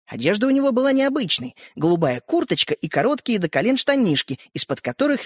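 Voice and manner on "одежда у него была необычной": ordinary delivery, nothing marked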